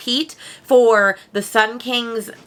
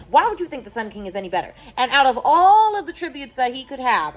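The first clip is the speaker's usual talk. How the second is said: normal talking voice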